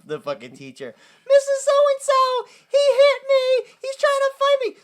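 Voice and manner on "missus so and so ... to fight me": put-on very high, slow whining child; whiny tattling kid. Child tattles to the teacher, whiny high kid voice.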